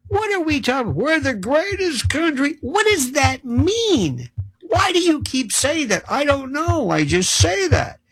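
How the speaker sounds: high, sing-song mockery of a pundit; mocking high voice. Mocking his own pundit clique with a high, sing-song voice.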